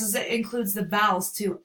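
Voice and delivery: ordinary talk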